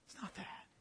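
breathy whispered aside. Real, not acted. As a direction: drop to a whisper. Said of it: "whispered aside".

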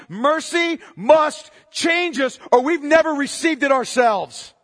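slow, loud, every word hammered; preached shout. Real, not acted. Preacher shouting the key line slowly at his flock. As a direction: drive it home to the congregation. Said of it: "preacher's climax".

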